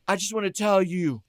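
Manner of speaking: high-pitched American banter, mimicking the singer; plainly American accent. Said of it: "in a plainly American accent".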